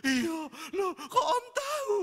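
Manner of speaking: put-on high, loud, slow sobbing boy's voice; hammed-up teen boy asking between sobs; crying teenage nephew. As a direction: mimic the startled weeping nephew. Nephew voiced crying in sermon story.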